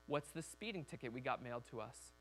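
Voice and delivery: quiet, lightly voiced stern parent; low-key mock parental confrontation; harsh parent. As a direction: softly question like a disapproving parent. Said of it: "Not like that harsh parent".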